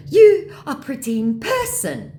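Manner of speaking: high, loud, slow flattery; flattering mock scammer. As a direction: lay on the scammer's compliment. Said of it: "he says".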